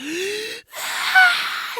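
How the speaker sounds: a sudden high gasp of pain, imitated; imitating the boy gasping as the needle goes in; sharp gasp. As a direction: suck in a sharp startled breath. Boy receives painful shot.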